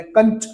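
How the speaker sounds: कंच said loud and high with a forceful nasal ं; strong anusvara demo. Shown as a demonstration. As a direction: punch the ं in कंच hard through the nose. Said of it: "वो अनुस्वार था". (कंच को ज़ोर से, तेज़ नासिक ध्वनि के साथ बोलना)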